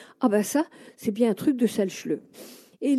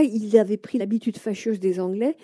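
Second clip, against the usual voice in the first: normal talking voice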